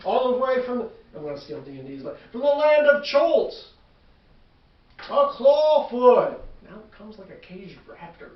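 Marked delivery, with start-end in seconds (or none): 0.0-6.4 booming ringside announcer voice; arena announcer. Acted. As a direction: announce it to the crowd.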